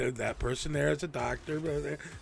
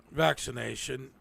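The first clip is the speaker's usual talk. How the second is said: ordinary delivery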